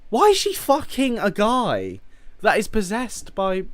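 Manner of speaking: high-pitched, exasperated outburst, loud at the start; really emotional rant. Real, not acted. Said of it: "I just get really emotional about it".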